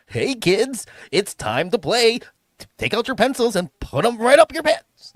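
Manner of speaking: Barney voice. Pretend. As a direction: beam at the kids like a dinosaur host. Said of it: "sound like Barney".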